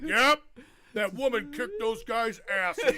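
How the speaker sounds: high, booming mock character voice; drawn-out, hammed-up yell; loud imitated exclamation. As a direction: shout it slowly as a comic impression. Voicing a character during a sex scene.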